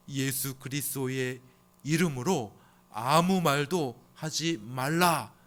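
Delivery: slow, loud commanding decree; stern official's order. Retold or imitated. Officials commanding the disciples, slow stern order. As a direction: lay down the ban slowly. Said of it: "아주 특별한 명령을 하게 되죠".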